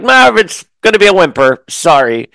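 retort to Marv. Retold or imitated. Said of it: "I'm like".

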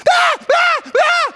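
very high, loud repeated screams; child's scream. Retold or imitated. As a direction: imitate a screaming child in piercing bursts. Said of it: "start hearing this child scream".

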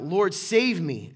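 loud, drawn-out cry for help. Acted. Sinking Peter cries to Jesus, crying out. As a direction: cry out slowly and loudly to be saved. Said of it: "he cried out".

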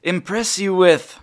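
high, loud showman genie. Acted. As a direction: dazzle the child like a stage magician. GM voices genie dazzling child.

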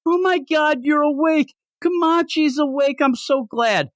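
high, breathless gush of relief; hammed-up joy, voice way up; overjoyed brother. Brother sees sister awake, high mock joy.

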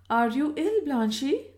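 concerned. A narrator's reading. Startled cousin asks if she is ill.

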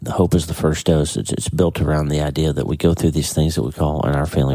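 ordinary talk, nothing marked

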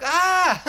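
high, loud yelp of fright; mock freak-out scream. Retelling the moment he finds a dead body, mock terrified scream. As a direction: imitate a startled scream on finding a body. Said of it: "he freaks out".

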